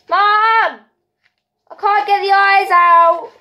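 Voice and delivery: calling out for mum. Real, not acted. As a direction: yell for Mom, stuck and frustrated. Child calling mum for help.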